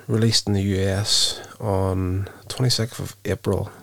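normal talking voice

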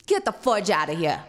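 loud mock New York brush-off; retold angry dismissal; gruff New York cop. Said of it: "a New York tater hater".

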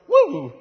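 high, loud spooky "woo"; spooked woo. Retold or imitated. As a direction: give a short creeped-out whoop. Mimics the creepy effect of noise.

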